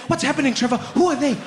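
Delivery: confused mom. Retold or imitated. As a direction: pepper him with puzzled questions, high. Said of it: "she'd be like".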